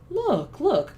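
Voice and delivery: sudden high excited call; eager boyish cry. A narrator's reading. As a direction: point it out, thrilled. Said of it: "cried Diggory".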